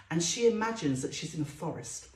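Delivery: plain reading voice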